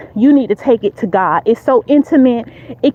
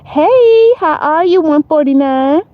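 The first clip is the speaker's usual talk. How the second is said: fake friendly greeting; 6 semitones higher than usual. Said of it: "she then took the opportunity to say".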